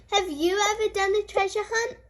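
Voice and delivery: usual speaking voice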